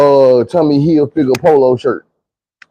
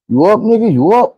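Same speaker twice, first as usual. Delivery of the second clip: friend's voice; 8 semitones higher than usual. Retold or imitated. Friend waking drunk Phil.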